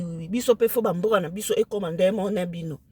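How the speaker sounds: ordinary delivery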